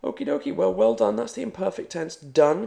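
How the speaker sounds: excited wrap-up. Real, not acted. Cheerful end of lesson.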